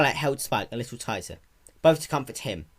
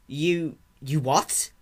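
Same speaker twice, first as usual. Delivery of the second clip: disbelief; 5 semitones higher, much slower than usual. Acted. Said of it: "eyes widened in disbelief".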